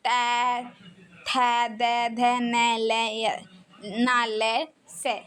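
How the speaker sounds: dental "तै, थै, दै" said one by one; sounding out letters. Shown as a demonstration. Teacher sounds dental letters for students to copy. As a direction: tap the teeth on each sound. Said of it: "देखिए, अब तै".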